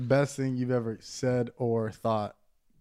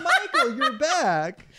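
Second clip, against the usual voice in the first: put-on very high, laughing girl's voice; mock delighted girl; 9 semitones higher, much slower than usual. Girl to him as he recovers, mock delighted. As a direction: squeal it high, half laughing.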